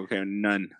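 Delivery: ordinary delivery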